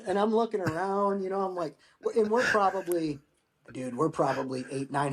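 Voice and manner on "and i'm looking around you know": ordinary talk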